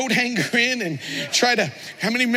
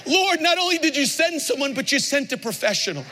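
grandma's joyful cry to God, higher; lifting her voice in thanks to the Lord; lifted-up voice; 7 semitones higher than usual. Retold or imitated. Grandmother praising God, pitch lifted. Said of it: "she lifted her voice".

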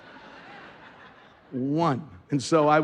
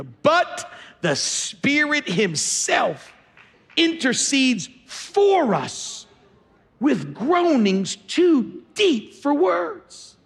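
scripture reading; 8 semitones higher, much slower than usual. Acted. Pastor reads scripture slowly.

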